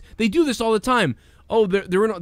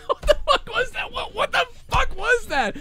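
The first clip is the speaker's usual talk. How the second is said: very high, laughing incredulity; squeaky disbelief. Real, not acted. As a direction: squeal it in baffled amusement. Incredulous at the acting.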